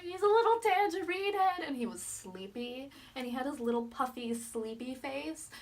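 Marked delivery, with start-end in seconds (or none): 0.0-1.6 gushing fan. Retold or imitated. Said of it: "I'm like".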